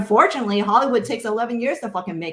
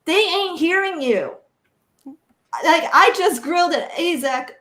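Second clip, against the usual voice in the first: impassioned; 8 semitones higher, 4 dB louder, slower than usual. Real, not acted. Impassioned rant to the panel.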